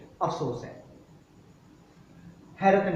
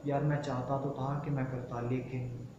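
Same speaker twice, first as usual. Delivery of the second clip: regretful soft voice; 4 semitones lower, 10 dB quieter than usual. Acted. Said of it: "आप कहना चाह रहे हैं".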